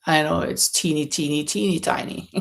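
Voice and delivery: ordinary talk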